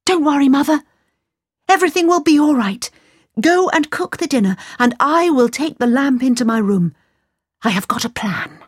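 calm Mother down, a plan in mind; reassuring young Aladdin. Acted. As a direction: son comforting his worried mother.

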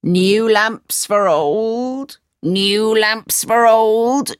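slow street-seller call; slow vendor's call. A narrator's reading. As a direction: hawk the lamps down the street. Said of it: "he called out".

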